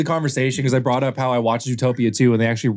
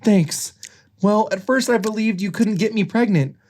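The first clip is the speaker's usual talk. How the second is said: Judy Hopps; 9 semitones higher than usual. Acted. Judy explains.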